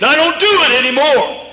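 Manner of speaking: emphatic. Retold or imitated. Boy emphatically denying, retold.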